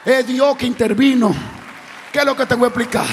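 loud, driving sermon climax; full-force preaching. Real, not acted. Preacher refusing to explain God's miracles, impassioned shouted preaching. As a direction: preach it hard, rising to long high shouts.

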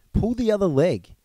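high-pitched, incredulous retort, lightly acted; scoffing disbelief. A narrator's reading. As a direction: brush off a tall tale. Thomas scoffs at the others' news.

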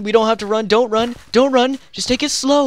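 normal talking voice

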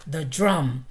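loud, grave "the drum"; name it gravely; solemn father mouse. Acted. Father insists.

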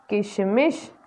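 ordinary delivery

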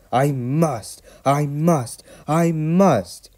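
high, straining repeats that climb; desperate, effortful determination; gasping. A narrator's reading. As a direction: force each one out with mounting effort. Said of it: "he gasped".

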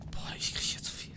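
breathy unvoiced groan of annoyance; exasperated whisper. Real, not acted. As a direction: hiss it out fed up. Frustrated whisper. (genervt geflüstert)

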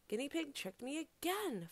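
high-pitched wail of frustration; outraged cry. A narrator's reading. Fox finds thorns instead of fire, crying out. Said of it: "Fox cried".

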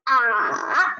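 slow 啊 dipping down, then rising; drawn-out dipping 啊; third tone. Shown as a demonstration. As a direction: bend 啊 downhill and back up. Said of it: "拐弯儿了".

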